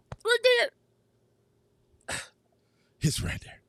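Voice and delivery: whispered, holding back emotion; choked-up whisper. Real, not acted. Host thanking his chat, whispering through emotion. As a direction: let it catch in your throat. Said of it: "I get a little emotional".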